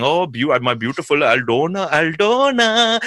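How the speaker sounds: lovesick, drawn-out Aldona; swooning over his wife; doting husband voice. Retold or imitated. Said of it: "he's with his wife Aldona and he's going".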